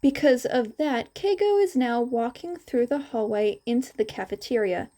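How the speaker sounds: normal talking voice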